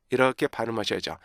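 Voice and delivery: ordinary talk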